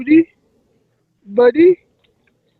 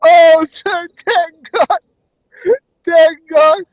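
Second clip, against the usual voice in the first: crying with relief; 7 semitones higher, 4 dB louder than usual. Acted. Relieved when someone answers.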